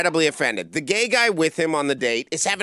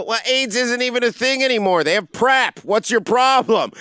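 much higher, louder, slowing into "what's your problem"; heated retort; 9 semitones higher, 5 dB louder than usual. Retold or imitated. Comedian yelling back at the offended man, loud and heated. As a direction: argue back at full volume.

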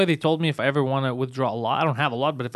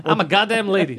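mock lady; 4 semitones higher, 3 dB louder than usual. Mocking teller's voice, far timbre.

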